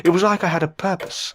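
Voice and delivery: normal talking voice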